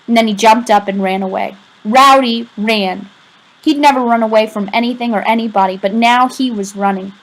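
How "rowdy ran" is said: ordinary delivery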